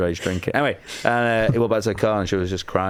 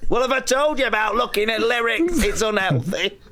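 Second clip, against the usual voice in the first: high mock-stern parent, half laughing; scolding parent; 12 semitones higher than usual. Parent scolding a child over hidden lyrics, mock-stern and high. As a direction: tell the kid off in a put-on high voice.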